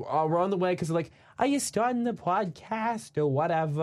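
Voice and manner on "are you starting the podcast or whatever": higher, slow impression of the two friends; lightly put-on voice of friends calling in. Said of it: "that's my best impression I can do of them".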